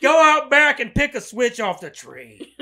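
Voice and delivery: loud, slow Southern order with laughter in it; hammed-up Southern dad. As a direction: holler a mock Southern punishment order. Continues Southern dad ordering kid.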